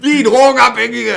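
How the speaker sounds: high, loud protesting question; hammed-up offended yell; mock indignant outburst. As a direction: shout it back in exaggerated outrage. Retold indignation at addict accusation.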